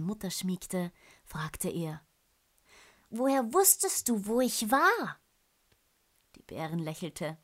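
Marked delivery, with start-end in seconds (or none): 3.1-5.2 high, loud curious cub; a small cub full of wonder; curious bear cub. Acted. Cub asks his mother.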